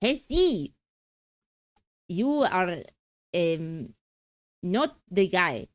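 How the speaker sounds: hammed-up Mexican accent from a crime drama; attempted Mexican accent. Streamer recalling Breaking Bad lines, mock Mexican accent. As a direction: put on a rough Mexican accent. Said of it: "What accent am I trying to do".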